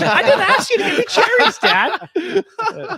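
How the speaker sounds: fed-up daughter cracking up as she protests; exasperated daughter. Retold or imitated. Daughter insisting to dad she never wanted cherries, laughing exasperation. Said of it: "She's like".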